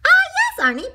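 high, loud opening cry of recognition; baker character. A narrator's reading. Baker recognizes donut on phone, hearty character voice. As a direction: greet him loudly, starting high.

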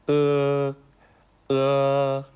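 "うー" as an o made with an e mouth, high, loud and long; Mandarin e vowel. Shown as a demonstration. Teacher showing a sound absent from Japanese, unrounded high loud "うー". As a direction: spread lips for e, voice o from the throat as "うー". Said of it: "えの口で、".

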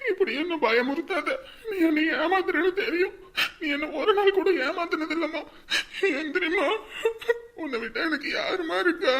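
tearful pleading, breaking into cries; sobbing. Acted. Son weeping over his dead mother, sobbing plea. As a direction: beg her to wake up through sobs.

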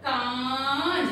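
कान with the aa drawn out very slowly; slow कान, holding its aa long; long vowel. Shown as a demonstration. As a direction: stretch the का in कान. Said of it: "का को हमने long बोला".

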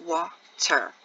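WATER broken as WA ... TER; WATER sounded out with a pause after WA; split into syllables. Shown as a demonstration. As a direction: say WATER in two separate beats. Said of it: "When you clap it out".

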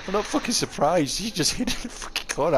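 high mocking sarcasm with a laugh; sarcastic. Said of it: "I'll be sarcastic when I said it".

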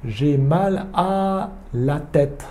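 slow, careful French model; slow model phrase. Shown as a demonstration. A teacher models the phrase slowly for Japanese students. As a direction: say it slowly for learners to repeat. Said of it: "Atama ga itai".

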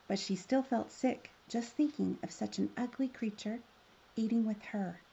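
usual speaking voice

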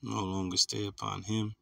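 low, soft skim of a verse; muttered to self. Real, not acted. Preacher hunting for his closing scripture, muttering to himself. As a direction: read it under your breath while searching.

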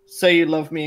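loud, taunting call. Acted. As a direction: blare it out to tease. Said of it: "really loud".

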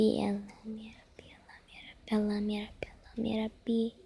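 unvoiced whisper, fading to barely audible; whispered pleading prayer. Real, not acted. Frustrated vlogger begging God over a bad edit, whispering. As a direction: mutter the prayer under your breath, fading away.

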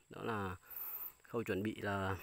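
hiccuping while talking; words broken by hiccups. Real, not acted. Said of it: "em đang nấc quá nhỉ".

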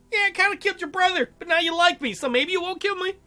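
fast, high mock voice. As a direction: rattle it off in a silly high voice. Mocking paraphrase of the plan.

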